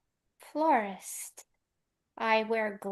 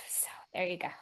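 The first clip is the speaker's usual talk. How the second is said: whisper; 3 semitones lower, 12 dB quieter, much faster than usual. Real, not acted. Closing her defense, whispering.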